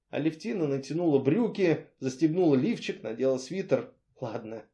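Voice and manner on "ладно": much softer, lower, giving up; resigned quiet. A narrator's reading. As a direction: let it drop, defeated. She gives up quietly.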